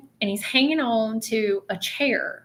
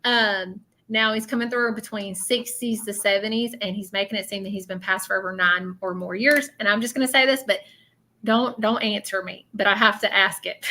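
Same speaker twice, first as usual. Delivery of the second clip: usual speaking voice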